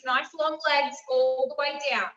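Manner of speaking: ordinary talk, nothing marked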